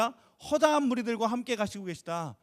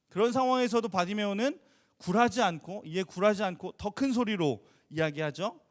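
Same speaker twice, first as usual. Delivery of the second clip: normal talking voice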